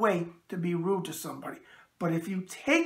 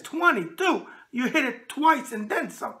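higher, louder quoted catchphrase; Debbie; 6 semitones higher, 4 dB louder than usual. Retold or imitated. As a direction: imitate her calling it out, pitched up. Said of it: "as, uh, Debbie says".

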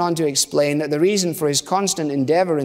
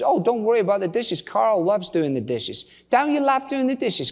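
higher-pitched retold wife's voice; breezy wife; 7 semitones higher than usual. Wife waving off guest's help, high and breezy. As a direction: chirp it high, brushing off the offer.

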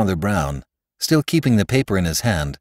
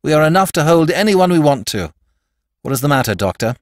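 loud, firm, higher command; 7 semitones higher, 6 dB louder than usual. A narrator's reading. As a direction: speak like an officer in charge. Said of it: "voice of steel of a soldier in command".

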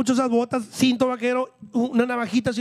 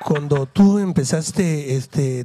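mock slow and much lower, overly peaceful drawl; imitating a calm guy; 7 semitones lower, 3 dB louder, much slower than usual. Teasing a podcast guest by aping his slow calm talk. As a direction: put on the mellow cowboy-hat guy's slow low voice. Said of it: "el vato bien pacífico que me hablaba".

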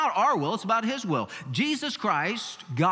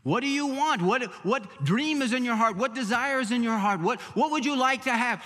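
rising higher, coaxing and eager; mock prosperity preacher; 5 semitones higher than usual. Preacher mimicking prosperity teachers, higher and eager. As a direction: hype them up like a salesman. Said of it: "Now we hear people say".

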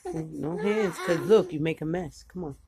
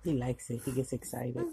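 tender; 6 semitones lower, 8 dB quieter than usual. Real, not acted. Soft talk to feeding baby.

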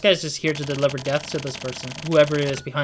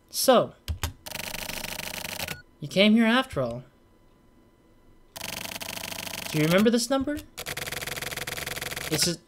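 mysterious game character; 3 semitones higher than usual. Acted. Reads game character dialogue.

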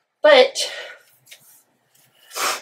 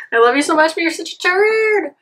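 higher pet-talk voice, turd falling; fond high scolding of the cat, saying turd; 7 semitones higher than usual. Real, not acted.

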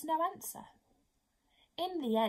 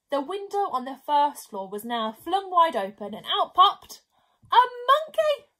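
ordinary delivery

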